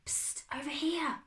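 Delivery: urgent stage whisper; loud whisper. A narrator's reading. Hidden girl calling her friend over, loud whisper. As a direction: hiss it over to someone nearby, whispering. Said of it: "came a loud whisper".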